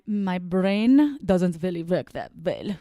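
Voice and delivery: slow mock German accent; accidental German accent. Host failing at Polish accent, slipping into slow German. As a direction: drag it out in a clumsy German accent. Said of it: "Yeah, that's German".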